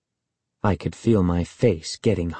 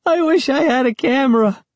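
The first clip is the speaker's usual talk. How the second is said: put-on high, smug girl taunting; teasing girl voice; 4 dB louder than usual. A narrator's reading. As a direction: rub it in like a bratty cousin. Sari taunts the narrator.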